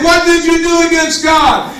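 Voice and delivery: normal talking voice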